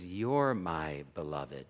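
whisper-close assurance of love; God's tender voice. Retold or imitated. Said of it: "God's voice comes through and says".

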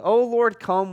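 a sudden lift of the voice in longing; longing cry. Retold or imitated. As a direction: cry out for his return. Paul's longing cry quoted.

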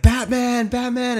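put-on excited toddler shout. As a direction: squeal "Batman" up high like a toddler. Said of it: "and he's like".

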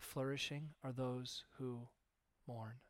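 soft, slow, tender pronouncement; gentle Jesus. Acted. Jesus gently telling mourners they flourish, soft and slow. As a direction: speak it softly as a blessing. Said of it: "Jesus is like".

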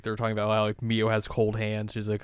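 ordinary delivery

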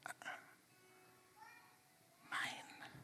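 a hushed, possessive whisper; greedy whisper. Retold or imitated. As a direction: hoard it in a whisper. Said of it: "we look at other people and we go".

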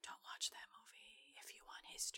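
hushed, breathy aside; whispering. Real, not acted. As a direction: whisper it like a secret warning. Conspiratorial aside about a movie.

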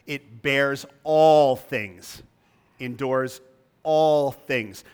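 all stretched slow and loud each time; stress on all. Shown as a demonstration. Preacher hammering all to his flock. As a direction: lean hard on every all, slowly. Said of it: "Notice especially the frequency of the word all".

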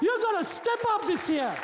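rally the church, pushing harder each line; fiery pulpit call to change; full-force preaching. Acted.

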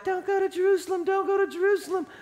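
high, fast, pleading friends. Retold or imitated. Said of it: "all of his friends are like".

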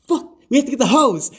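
high, loud alarmed outburst; rally everyone in a sudden panic; retold urgent shout. Host re-enacting realising how to fight the fire, urgent shouting.

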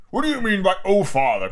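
mildly indignant father, dropping back down; demanding. A narrator's reading. Father demands explanation from daughter, indignant. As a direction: challenge the child's words. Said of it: "Mister Darling demanded".

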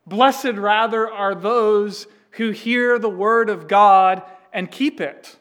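preacher voicing Jesus, loud and slow; firm declaration. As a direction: proclaim it as a correction. Voicing Jesus correcting the blessing.

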